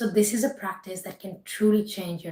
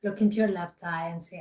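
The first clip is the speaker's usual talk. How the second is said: ordinary talk, nothing marked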